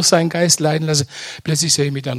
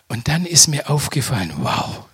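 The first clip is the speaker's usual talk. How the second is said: ordinary talk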